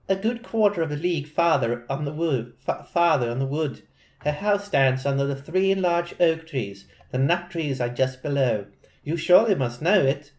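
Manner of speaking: put-on a light British accent for the girl; mild British girl's voice. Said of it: "making her British".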